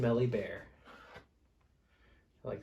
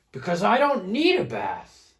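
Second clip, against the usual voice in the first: higher, louder stubborn bear; stubborn bear reply; 7 semitones higher, 9 dB louder than usual. Acted. Big Smelly Bear refuses the bath, higher and louder. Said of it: "replied Big Smelly Bear".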